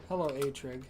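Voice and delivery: ordinary talk, nothing marked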